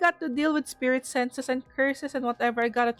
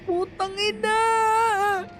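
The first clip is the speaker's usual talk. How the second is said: drawn-out curse; 7 semitones higher, much slower than usual. Real, not acted. Reacting to murderous game text.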